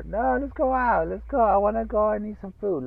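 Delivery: mock pleading wife. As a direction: whine it like a stubborn wife. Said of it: "You know how fucking girls are".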